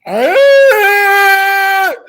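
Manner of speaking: high, loud startled scream; sudden loud yelp of fright. Retold or imitated. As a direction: shriek as if jolted awake. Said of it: "I was like".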